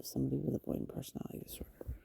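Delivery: 1 whispered. Real, not acted.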